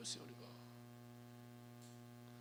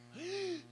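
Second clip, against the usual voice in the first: mock gasp of delight. Visitor awed by dishwasher.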